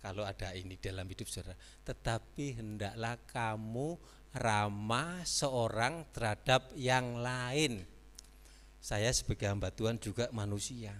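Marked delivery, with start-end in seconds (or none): 1.9-7.9 very slow, deliberate verse; slow teaching pace; slow emphasis. Shown as a demonstration. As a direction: draw out each word for emphasis. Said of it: "repeats verse slowly".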